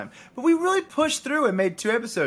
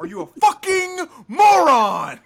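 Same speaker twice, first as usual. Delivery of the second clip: mock furious shout; 7 dB louder, slower than usual. Playful outburst at cohost.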